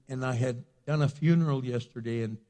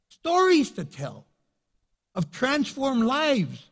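raised-pitch, driving sermon delivery; impassioned preaching; 7 semitones higher, 6 dB louder than usual. Acted. Pastor urging the church to tell their stories, impassioned preaching. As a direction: pile up the testimonies and build to the peak.

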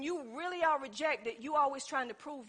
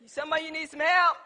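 louder emphatic repeat; 3 semitones higher, 7 dB louder than usual. Real, not acted. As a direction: hit it harder the second time. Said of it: "repeated for emphasis".

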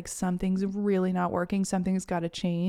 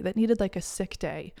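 usual speaking voice

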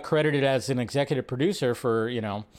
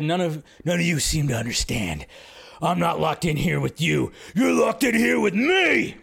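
Jackie Earle Haley as Rorschach. Retold or imitated. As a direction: growl it out, then yell the final threat. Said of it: "trying to do my best Haley impression right there".

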